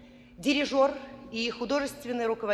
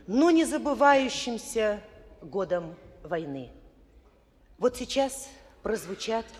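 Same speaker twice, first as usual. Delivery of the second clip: normal talking voice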